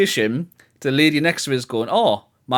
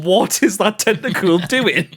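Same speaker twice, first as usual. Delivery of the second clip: mock bewildered friend; 5 semitones higher, slower than usual. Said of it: "You remember you going".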